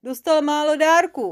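high, loud muffled complaint; aggrieved monster talking through fabric; muffled mumbling monster. A narrator's reading. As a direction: grumble as if wrapped in cloth. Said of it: "ozvalo se huhlavě spod týpí".

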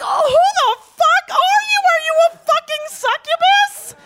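put-on a boyfriend ranting at his girlfriend in outrage; outraged shout. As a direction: shout in wild disbelief.

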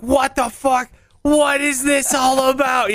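retold "what on earth" reaction; acted appalled disbelief. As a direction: gawk at someone's bizarre behavior. Acts out his appalled reaction.

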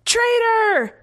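very high squeaked accusation. A narrator's reading. Choking commander accusing soldier, squeaked. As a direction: squeak it out in outrage. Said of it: "the commander squeaked".

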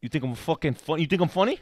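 lightly voiced Italian-American mobster; mock Italian-American gangster, rising at the end; Sopranos Italian-American accent. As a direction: challenge like an Italian-American wiseguy. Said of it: "Sopranos kind of voice".

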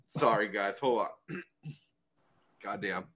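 ordinary delivery

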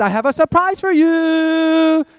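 put-on high, slow, sweet child voice; little daughter's voice. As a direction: play the little girl announcing a surprise. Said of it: "they come into our room and they".

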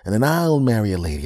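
ordinary delivery

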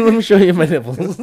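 high laughing tease. Real, not acted. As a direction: giggle through the words.

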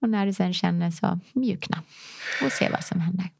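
soothing breathwork guide; guided breathing. Acted. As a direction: lead them through the breath gently. Leads a breathing exercise.

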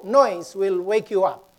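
usual speaking voice

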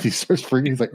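ordinary talk, nothing marked

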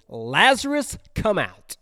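high ringing shout into the tomb; loud call. Acted. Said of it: "called out in a loud voice".

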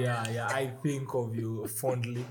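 playful fake-serious tenderness; mock tender. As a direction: savour each word like a lovestruck suitor. Mock romantic declaration.

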